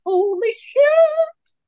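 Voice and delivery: high, slow, drawn-out exclamation; exclaiming. Real, not acted. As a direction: stretch it out in disbelief. Exclaiming over the packing struggle.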